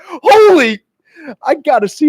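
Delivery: sudden loud high yelp; panicked outburst. Acted. Stoned friend suddenly panics about his pants, high yelp. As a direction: blurt it out in shock.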